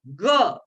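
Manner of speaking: ग hit high and loud from the belly; hard ग from the navel. Shown as a demonstration. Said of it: "ग, ये भी कठोर वर्ण है". (ग को नाभि से ज़ोर से, ऊँचा बोलें)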